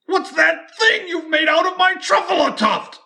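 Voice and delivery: very high, loud indignant cry; upset shout. Acted. Lorax demanding what the Once-ler made, high angry shout. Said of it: "he shouted and puffed".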